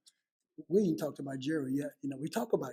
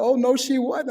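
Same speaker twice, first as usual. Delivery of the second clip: mock loud protest from the sisters, pitched up; sisters' retort; 8 semitones higher, 12 dB louder, slower than usual. Sisters contradicting him about mama, high and loud. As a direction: toss it back high and loud like a sister objecting.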